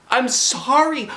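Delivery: put-on a small girl begging not to be yelled at; tearful apology. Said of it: "I said".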